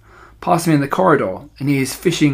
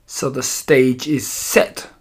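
plain reading voice

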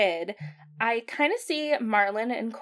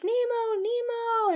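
high, drawn-out imitated calling; father calling son; 13 semitones higher, slower than usual. Father fish finding his lost son, high calling. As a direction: call out like a searching dad, without shouting. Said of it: "and he's like".

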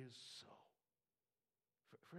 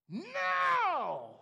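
a sudden, much higher full-voice cry of now; shouted now; 17 dB louder than usual. Real, not acted. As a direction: burst out with the word, all in. Preacher builds to a cry.

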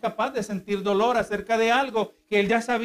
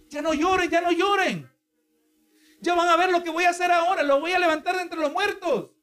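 much higher, eager voice, hurrying to reassure the mourners; mock all-powerful Jesus; 9 semitones higher than usual. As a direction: play a self-assured savior brushing off the tears, pitched up high. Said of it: "imagínese siendo el Todopoderoso".

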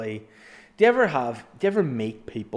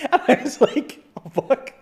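laughing through the words; high, giggling retelling; high laughing retell of a shocked curse; 13 semitones higher, 7 dB louder than usual. Retold or imitated.

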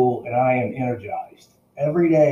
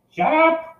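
high squawking parrot imitation; shrill mimicked bird call. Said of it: "the parrot goes again".

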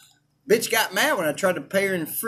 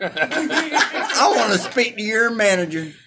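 slow, whiny Karen with a laugh; 3 semitones higher, slower than usual. Pretend. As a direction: stretch it out like an entitled Karen.

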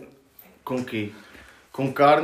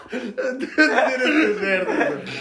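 laughing; 7 semitones higher, much slower than usual. Real, not acted. Laughing at the image.

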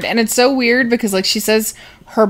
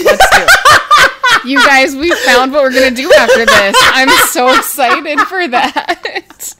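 excited; 8 semitones higher, 8 dB louder than usual. Real, not acted. Thrilled about new podcast idea.

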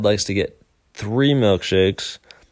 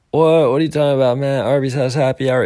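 mock disbelief; 5 semitones higher, 3 dB louder, faster than usual. Voices imagined listener's disbelief.

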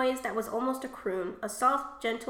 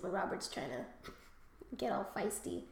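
ordinary delivery

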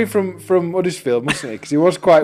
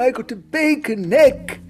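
mock silly voice; 7 semitones higher than usual. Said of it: "we're talking like this".

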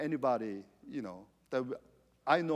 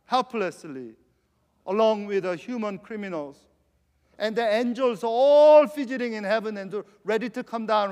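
ordinary talk

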